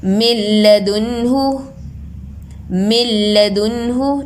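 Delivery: मिन लदुनहु slow, न dropped into a doubled ल; no nun sound. Shown as a demonstration. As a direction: recite मिन लदुनहु as मिल्लदुनहु, slowly. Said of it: "नून का sound ज़रा भी नहीं आ रहा".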